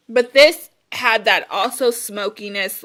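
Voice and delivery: usual speaking voice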